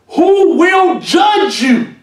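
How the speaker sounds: high, slow, shouted warning; preacher warning ministers of God's judgment; preaching shout. Real, not acted.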